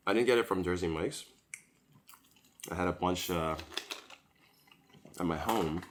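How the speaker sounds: ordinary talk, nothing marked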